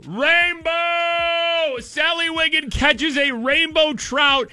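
high, shouted play-by-play; mock triumphant commentary. Host calls angler's trout catch, mock triumphant commentary. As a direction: call the catch like a winning goal.

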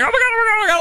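very high, rushed mimicry of an excited kid; mock-frantic high squeal of words; imitated frantic nephew. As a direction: blurt it like a kid racing back. Nephew running back after missed goal.

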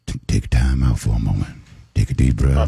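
low, slowed-down soothing voice; calming. Real, not acted. Host calming an excited rambling caller, low and soothing. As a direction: settle the caller down gently.

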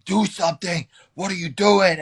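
mock-yell it like an angry teammate; retold yell. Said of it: "Khabib's yelling at me".

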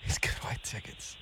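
hushed whisper. Real, not acted. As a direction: speak softly, someone's close. Host echoes in whisper.

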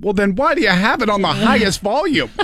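high, disbelieving complaint that breaks into a laugh; exasperated retort. Retold or imitated. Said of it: "And I said".

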